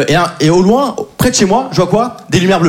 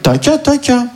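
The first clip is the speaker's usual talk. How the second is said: higher, odd put-on "taca taca"; mock street drill; 6 semitones higher, 3 dB quieter, slower than usual. Comedian imagining doing the exercise in the street, weird high voice. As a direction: strange night prowler repeating syllables. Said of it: "j'aille dans la rue, la nuit, normal, en mode".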